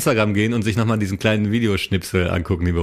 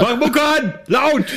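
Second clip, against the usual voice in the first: much higher, laughing yell; drunk yelling outside; much slower than usual. Pretend. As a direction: shout for music like a drunk. S1 acts drunk partying. (lachend betrunken grölend)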